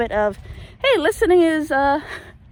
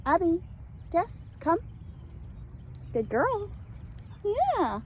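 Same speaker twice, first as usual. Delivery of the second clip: calling the dog; 7 semitones higher, 10 dB quieter than usual. Real, not acted. Calling dog, then praising her.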